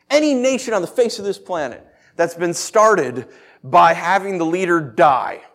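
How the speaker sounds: normal talking voice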